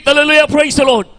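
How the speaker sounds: preacher showing the shout of praise. Shown as a demonstration. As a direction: call it out like a shout to the Lord.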